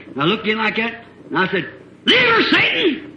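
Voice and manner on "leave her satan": very high, slow, shouted command; exorcism shout. Retold or imitated. Preacher casting Satan out of the girl, high slow shout. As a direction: cast the devil out with full force.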